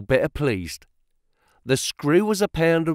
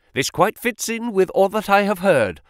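raised pitch, full of admiration; delighted exclamation; 7 semitones higher than usual. A narrator's reading. Financial agent impressed by the clerk's market knowledge, delighted exclamation. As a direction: carry on the impressed cry, pitched up. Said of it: "he cried, with his hands up".

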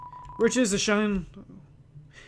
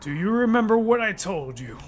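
low, soft, menacing king; king father; 3 semitones lower, 5 dB quieter than usual. Acted. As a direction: speak down to his son coldly.

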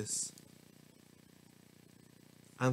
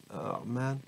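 ordinary delivery

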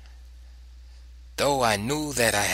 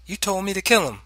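high, louder protest; child's protest; 10 semitones higher, 5 dB louder, faster than usual. A narrator's reading. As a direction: protest to father in a child's raised pitch. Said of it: "I said".

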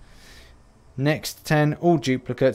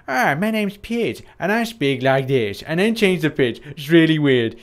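PewDiePie impression; 3 semitones higher than usual. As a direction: tease a fellow YouTuber by aping his lilt. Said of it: "PewDiePie does actually sound a little bit like that".

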